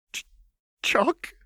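halting, high frightened stammer; scared servant stammering. A narrator's reading. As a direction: trip over his own name in fear. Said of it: "he stammered".

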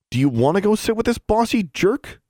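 high, incredulous challenge; indignant question. A narrator's reading. Alexandra challenges two girls about the bossy boy, high indignant question. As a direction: pitch it way up in scornful disbelief.

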